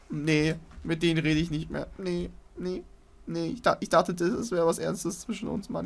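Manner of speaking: high-pitched, jilted sulking; mock heartbreak. Player thought it was serious between him and the monster, mock hurt sulk. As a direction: whine like a dumped lover, playfully.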